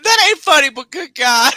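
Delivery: can't stop laughing at something awful; guilty laughter breaking the voice up high; laughing disbelief. Real, not acted. Laughing at a clip about lost toes.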